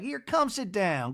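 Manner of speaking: high, slow matron voice; Miss Patricia. Retold or imitated. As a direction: imitate a hostess beckoning, drawn out. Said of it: "Miss Patricia's like".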